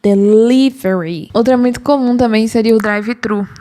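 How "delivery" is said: Delivery, slow, syllable li pushed hardest; stress on li. Shown as a demonstration. Teacher modelling English stress on Delivery. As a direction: lean on the li in Delivery, slowly. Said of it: "o mais forte é falar a sílaba li".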